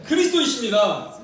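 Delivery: preacher calling out a creed; call to repeat. Shown as a demonstration. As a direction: lead the congregation in a loud confession. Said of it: "자, 따라하십시오".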